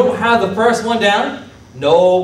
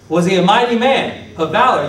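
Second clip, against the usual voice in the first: ordinary talk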